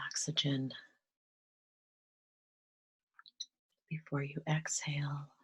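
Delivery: soft, calm meditation voice with whispered stretches; gentle guided meditation, drifting into whispers. Acted. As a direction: ease them into stillness, half-whispering.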